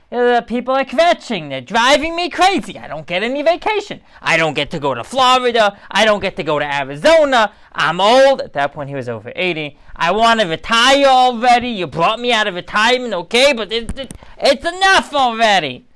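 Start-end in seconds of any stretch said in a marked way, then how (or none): 0.1-8.4 high, loud whiny complaint; kvetching Moshe. Retold or imitated. Moshe complaining to Hashem, high whiny kvetch. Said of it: "I don't think Moshe sounded exactly like that". 10.0-15.9 high, loud whiny complaint; kvetching Moshe. Retold or imitated. Moshe complaining to Hashem, high whiny kvetch. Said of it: "I don't think Moshe sounded exactly like that".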